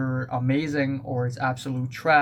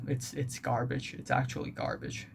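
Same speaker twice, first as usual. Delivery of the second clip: quiet; 8 dB quieter than usual. Real, not acted. Quiet dismissal.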